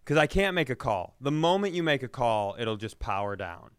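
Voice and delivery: ordinary delivery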